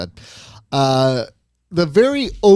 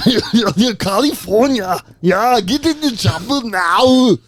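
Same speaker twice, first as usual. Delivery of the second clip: slow, higher hammed-up action-hero voice; Arnold; 6 semitones higher, 4 dB louder, slower than usual. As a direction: growl it like a movie muscleman, slowly. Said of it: "It's just Arnold".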